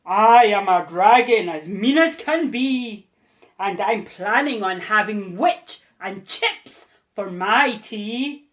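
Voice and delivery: loud menacing dragon roar; scary roaring dragon. Acted. Dragon threatening the witch, loud scary roar. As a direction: roar it out to scare her. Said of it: "a roar that was scary and loud".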